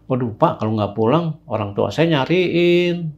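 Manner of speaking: soft, hesitant plea stretched at the end; meek pleading. Retold or imitated. Young man worried his parents will search, pleading softly. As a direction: plead quietly, dragging the last words.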